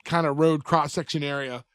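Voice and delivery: usual speaking voice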